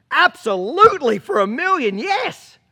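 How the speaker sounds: high, loud, gushing yes from the mother; eager mother voice. Retold or imitated. Mother eagerly agrees to the deal, high and loud. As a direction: squeal it out eagerly, pitched well up.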